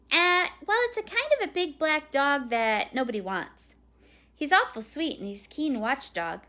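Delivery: a hint of a kid pitching hard; persuasive boy. A narrator's reading. Boy selling mom on a dog, persuasive boy.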